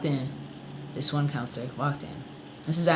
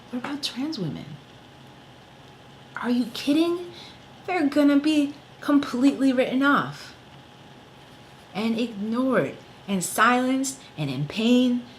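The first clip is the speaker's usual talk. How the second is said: rally hard for others; real indignation, building; passionate outrage; 7 semitones higher than usual. Real, not acted.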